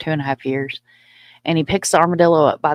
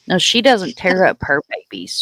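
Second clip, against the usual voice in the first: ordinary talk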